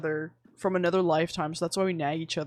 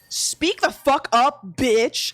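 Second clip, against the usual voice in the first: slow, louder, drawn out insult; mock demand; 5 semitones higher, 6 dB louder, much slower than usual. Telling the group what to shout at mumblers, slow and loud. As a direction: spell out each word like a bossy joke.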